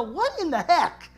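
slow, drawn-out disbelief; mock baffled reaction. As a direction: let the confusion hang. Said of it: "I read and go".

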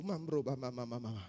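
low, soft babble under the breath; timid tongues. Retold or imitated. Captive secretly begins to speak in tongues, low and soft. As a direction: start praying in tongues cautiously, keeping it low. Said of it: "I began to speak in tongues".

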